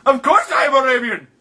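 put-on Arabian accent, lively and insistent; hammed-up Arabian-accented prank voice. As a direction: play an indignant caller with a thick Arabian accent.

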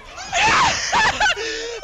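high shrieking laugh; screaming laugh. Real, not acted.

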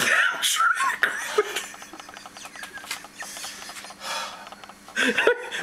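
shrieking laughter. Real, not acted.

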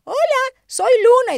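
high, loud puppet voice; squeaky pretend dog talking; child voicing the puppy Luna; cute dog voice. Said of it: "se la ponía aquí en la cabeza".

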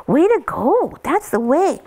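high, slow, swooping praise; really good praise. Shown as a demonstration. Trainer showing how to reward puppies, high drawn-out praise. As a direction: stretch it out in a high, pleased coo. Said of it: "Just that really good".

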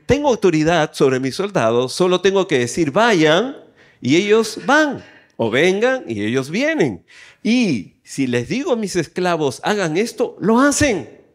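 emphatic reading that rises on the commands; scripture proclamation. A narrator's reading. Preacher reading how soldiers obey the officer, emphatic proclamation. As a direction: punch out each command and its result. Reads Bible aloud to congregation.

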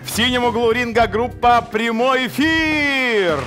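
high, drawn-out ring announcer call; boxing ring announcer. Acted. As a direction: stretch the band name like a fight intro.

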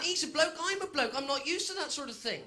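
ordinary talk

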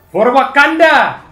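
loud, drawn-out rallying cry; battle cry. Pretend. Player charging into a game fight, battle cry.

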